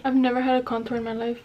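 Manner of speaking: ordinary delivery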